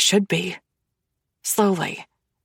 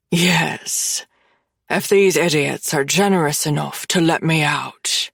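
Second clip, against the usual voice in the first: Irish brogue sneer; 3 semitones lower than usual. A narrator's reading. Seamus mocks his jailers.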